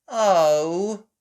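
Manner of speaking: put-on high, loud, drawn-out child's sigh. Child finding the beach snowy, sighing. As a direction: let it sag out theatrically. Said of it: "sighed Peppa".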